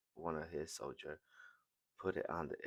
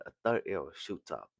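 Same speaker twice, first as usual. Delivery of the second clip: usual speaking voice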